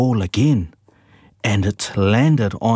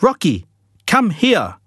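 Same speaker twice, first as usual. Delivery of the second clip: calling out; 11 semitones higher than usual. A narrator's reading. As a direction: shout for the dog to come back. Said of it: "shouted Mum".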